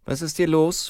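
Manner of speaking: high female officer's question; policewoman. A narrator's reading. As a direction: demand an explanation as a policewoman. Fragt die Polizistin.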